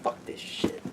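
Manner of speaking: breathless whispered swearing; muttered whisper of disgust; whispered curse. Retold or imitated. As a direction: hiss it under your breath. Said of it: "mocking characters' reaction".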